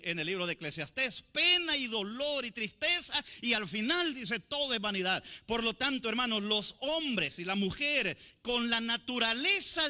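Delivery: preaching with rising force; fervent preaching. Acted. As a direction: press each word on the congregation.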